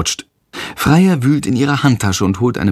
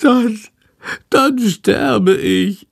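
high, long-drawn dying moan; pitiful, stretched-out high lament; whining; 10 semitones higher, much slower than usual. A narrator's reading. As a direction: wail it slowly in a squeaky creature voice. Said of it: "jammert Shandor".

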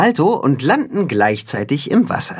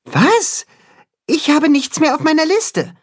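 much higher alarmed question; startled. Acted. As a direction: jump at the news. Max startled, checking list.

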